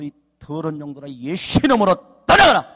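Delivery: sudden loud shout building to the end; re-enacted booming exorcism cry; exorcism command. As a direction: command spirits out forcefully. Re-enacts casting out spirits.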